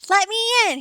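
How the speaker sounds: wolf howling to be let in. A narrator's reading. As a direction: howl it while banging on the door. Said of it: "he howled".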